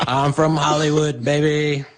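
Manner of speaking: high, slow Hollywood swagger; put-on showbiz voice. [name] hams up a Hollywood voice for Alex. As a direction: drawl it like a Hollywood type. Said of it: "I don't talk like this".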